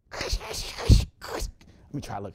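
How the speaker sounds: slow, hushed whisper to himself; slow whispered muttering while tuning the radio. Acted. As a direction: breathe it out while fiddling with the dial.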